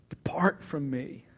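slow hushed whisper; whispered plea. Retold or imitated. Peter on his face before Jesus, slow whisper. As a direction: breathe it out, overwhelmed.

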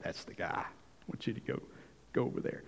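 soft urging whisper; nudging whisper. Retold or imitated. The Spirit nudging Philip toward the chariot, whispered. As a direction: breathe it like a quiet inner nudge. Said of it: "and the Spirit nudges him and says".

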